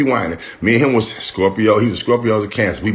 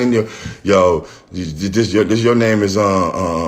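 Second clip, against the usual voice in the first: normal talking voice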